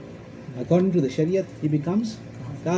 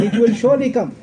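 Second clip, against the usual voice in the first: raised, louder, hammering SURELY COME; emphatic; 6 semitones higher, 5 dB louder than usual. Shown as a demonstration. Preacher explaining the word for emphasis, SURELY stressed high. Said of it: "la there is also for emphasis".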